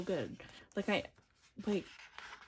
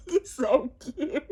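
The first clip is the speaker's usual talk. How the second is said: on the verge of tears; 3 semitones higher, 6 dB louder, faster than usual. Real, not acted. Fan seeing favorite's photo card, tearful wail. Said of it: "I'm literally about to start crying".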